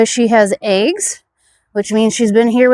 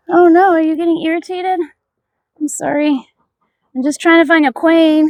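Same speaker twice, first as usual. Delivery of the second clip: higher-pitched pet-talk voice; cooing to bees; 7 semitones higher, 3 dB louder than usual. Pretend. Beekeeper apologising to irritated bees, high cooing. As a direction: apologise to the bees as if to little pets.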